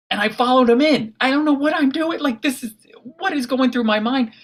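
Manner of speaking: high, loud, breathless panic; panicked. Real, not acted. Frightened storyteller reliving the freezer moment, high panicky voice. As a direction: voice climbing in alarm as the door shuts.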